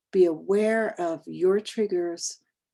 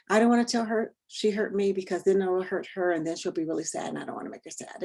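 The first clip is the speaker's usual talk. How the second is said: rushed inner worry; faster than usual. Retold or imitated. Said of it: "So we're always like".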